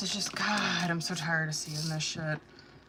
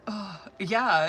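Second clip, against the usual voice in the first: usual speaking voice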